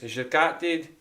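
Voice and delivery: a teasing Scottish question; Scottish phrase. Shown as a demonstration. Explaining trouser slang to chat, light Scottish. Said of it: "That's what they say in Scotland".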